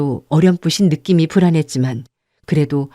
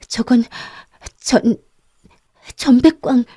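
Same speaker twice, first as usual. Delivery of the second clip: higher, halting woman's voice; faltering stammer; 6 semitones higher than usual. A narrator's reading. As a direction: stammer it out in broken pieces. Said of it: "낮은 소리로 떠듬거렸다". (더듬거리는 높은 목소리)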